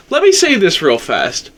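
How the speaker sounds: normal talking voice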